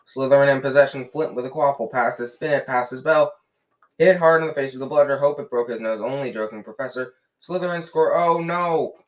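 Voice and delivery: loud, excited match commentary; commentator. A narrator's reading. Lee Jordan calling the match to the crowd, loud commentary. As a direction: call it fast and partisan.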